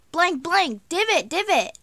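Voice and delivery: high, loud imitation of calling someone; called-out warning. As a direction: call out the name and warning. Calling her name as a warning.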